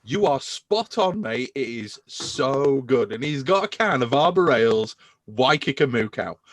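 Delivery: normal talking voice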